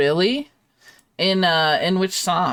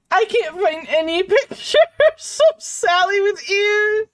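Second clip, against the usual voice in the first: laughing so hard it cracks; high, loud helpless laughter; 5 dB louder than usual. Real, not acted. Laughing at the drawing.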